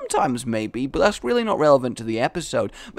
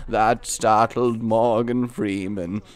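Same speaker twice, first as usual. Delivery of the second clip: low, slow narrator impression; Morgan Freeman; 5 semitones lower, slower than usual. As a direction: drop back into a low, slow celebrity voice. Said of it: "I can't do it anymore".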